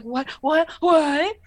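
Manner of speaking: mock panic as the magic fails; freaking out. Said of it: "he's freaking out".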